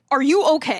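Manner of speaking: imitating alarmed concern. Comedian onstage to a woman in the crowd, concerned. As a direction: check on someone who seems unwell. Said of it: "it was a level of concern".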